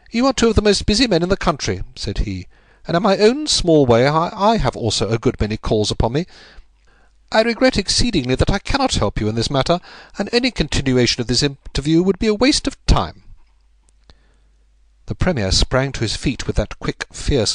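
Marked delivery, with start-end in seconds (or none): 0.1-1.8 higher, quick and dry refusal; Holmes, politely dismissive. A narrator's reading. 2.9-13.2 higher, quick and dry refusal; Holmes, politely dismissive. A narrator's reading. Narrator voicing Holmes.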